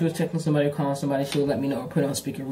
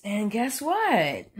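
slow, higher tease; slow reveal; 6 semitones higher, much slower than usual. Real, not acted. Telling viewers the ex has a boyfriend, slow and teasing. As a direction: stretch it out to tease.

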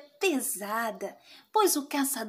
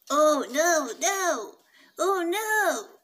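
dismayed wolf; 3 semitones higher than usual. Acted. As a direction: wail as the wolf is caught.

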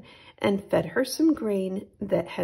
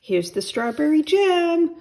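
high, loud happy shout; excited shout; 8 semitones higher, 6 dB louder than usual. Acted. Boy shouting to his mother about the jam. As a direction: call out the jam proudly. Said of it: "shouted Jack".

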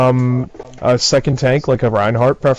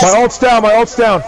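urgent callout; 8 semitones higher than usual. Real, not acted. Urgent callout losing ult.

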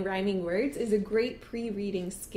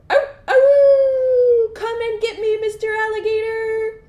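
high, loud howling singsong call; long high barking taunt; taunting dog howl; 13 semitones higher, 13 dB louder than usual. Acted. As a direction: howl out a teasing dare like a dog. Dog taunts alligator from porch.

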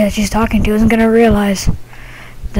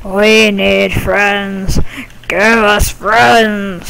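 very slow, drawn-out ghostly chant; spooky ghost; much slower than usual. Pretend. Ghost haunting people for friends, slow eerie intoning. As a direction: stretch every word like a haunting ghost.

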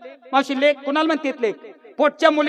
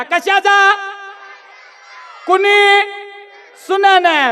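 ordinary talk

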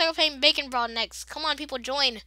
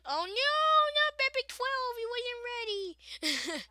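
mock dismay; 10 semitones higher, 3 dB quieter, slower than usual. Friend dies in game.

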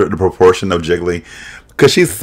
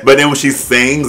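ordinary talk

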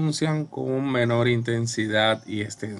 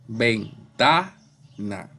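ventana drawn out slowly, ven-TA-na, with TA emphasised; stress on TA; much slower than usual. Shown as a demonstration. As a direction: slowly break ventana up and lean on TA. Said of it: "La sílaba tónica está".

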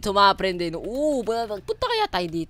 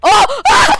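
sudden high scream; startled scream; 14 dB louder than usual. Real, not acted. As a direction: yelp then piercing shriek. Sudden scare in game.

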